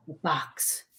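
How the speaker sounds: softly, dropped low, the box; a hushed echo from the spirit; faint ghost voice. Retold or imitated. The spirit repeats Al's words on tape, softly. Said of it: "And then you hear".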